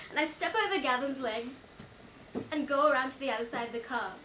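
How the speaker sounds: ordinary talk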